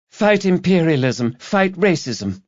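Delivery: put-on high, earnest young woman chanting slogans; young activist girl. A narrator's reading. Student collector to pub drinkers, high earnest slogan voice. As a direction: pitch her voice up like a keen student campaigner.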